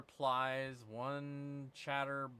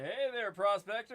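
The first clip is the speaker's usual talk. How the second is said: mock friendly greeting; 8 semitones higher, 7 dB louder than usual. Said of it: "and be like".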